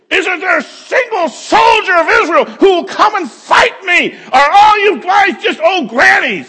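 high, loud, taunting yell; Goliath yelling. Retold or imitated. Giant taunting the soldiers of Israel, loud high yell. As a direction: bellow a taunt across the valley. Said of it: "he's just yelling out".

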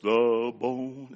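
low, slow, put-on solemn voice; mock-solemn little girl's low voice; changed child voice. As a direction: drop the voice deep and drag it out like a three-year-old playing grave. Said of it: "change her voice".

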